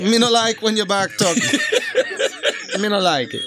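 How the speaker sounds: lightly voiced Jamaican patois scolding; half-hearted Jamaican accent telling her off; mock Jamaican accent. To the co-host, a mild mock Jamaican accent scolding back talk.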